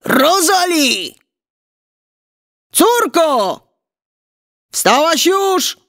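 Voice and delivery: high, drawn-out call; giant calling out. A narrator's reading. Giant father waking his daughter, calling out. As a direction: call slowly through the house for your daughter. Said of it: "Olbrzym zbudził się i zawołał".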